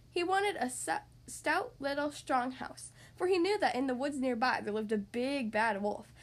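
plain reading voice

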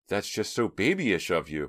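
a touch of hurt tremble; mildly shaken reproach; trembling voice. A narrator's reading. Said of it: "her voice trembling".

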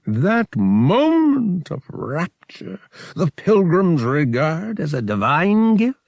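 high, slow, booming alien priest; a big, pompous alien creature savoring his words. A narrator's reading. Alien priest Verotil speaking.

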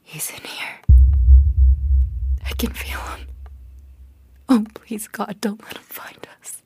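trembling hushed prayer; terrified whisper. Acted. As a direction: whisper in terror. Hiding in bathtub, terrified.

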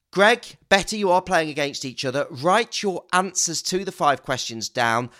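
cheesy game show host welcome; host it like a saturday night dating show; flirty teasing dating host. Acted. Hosting a dating-show-style quiz.

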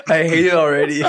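high squealing laughter; cracking up mid-line; laughing. Real, not acted. Laughing at the haiku.

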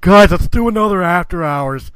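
put-on loud, slurring drunk caller. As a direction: play it tipsy and loud. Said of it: "drunken phone call from me".

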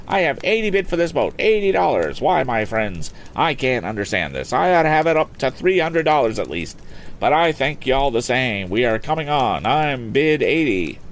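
high, brisk auctioneer selling a boat; auctioneer's pitch. Acted. Auctioneer coaxing bids from the lakeside crowd, lively and high.

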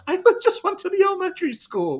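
very high, loud mock protest; defensive mom. Mom explaining herself to the cop, comically defensive.

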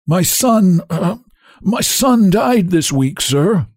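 high, strained, halting voice of a grieving cabman; husky, hesitant, slow confession; huskily. A narrator's reading. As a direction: force it out slowly through a tight throat. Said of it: "brings out huskily".

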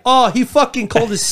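put-on high, indignant Aussie accent; offended Australian, played for laughs; mock Australian accent. Imitating Australians hearing a slur, mock-outraged Aussie accent. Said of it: "Everybody's like".

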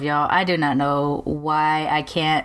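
ordinary talk

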